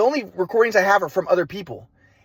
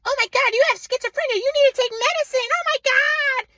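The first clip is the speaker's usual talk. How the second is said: piercing high-pitched hysterical mother imitation; mom freaking out. Mom told of her son's theory, freaking out shrilly. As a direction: panic in a very high mock-mom voice, last word stretched. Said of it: "she was, like, freaking out".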